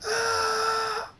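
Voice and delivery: high, sustained scream; agonized scream. A narrator's reading. Zapper in the endless massacre, screaming. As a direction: scream out in pain. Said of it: "Zapper screams".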